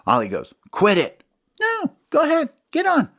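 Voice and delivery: high taunting critter voice; sing-song it up high; Smalls' voice. Acted. Smalls taunting Ollie to get back on. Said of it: "Smalls gets off and he goes".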